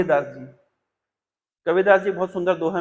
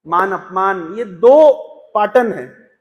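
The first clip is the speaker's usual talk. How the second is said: ordinary delivery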